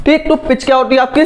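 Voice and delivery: ordinary talk, nothing marked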